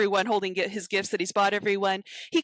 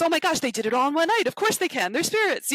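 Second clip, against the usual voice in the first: high, fast, giddy rush; 8 semitones higher, faster than usual. Retold or imitated. Scrooge giddy on Christmas morning, high and fast. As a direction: bubble over like a thrilled schoolboy. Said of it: "he's giddy as a schoolboy".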